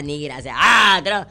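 normal talking voice

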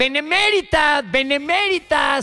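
mock the deputy by repeating benemérita slowly; high, slow benemérita, aping the deputy's misreading; mocking repetition. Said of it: "Y lo repite".

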